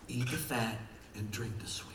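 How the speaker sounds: breathy unvoiced whisper of a verse. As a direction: hush it, like a secret blessing. Whispered scripture line.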